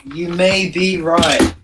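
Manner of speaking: "may be right" slow, word by word; deliberate hedging. Shown as a demonstration. Guest conceding the rumour to the host, slow and deliberate. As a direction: draw out MAY and BE separately. Slows the hedge to single words.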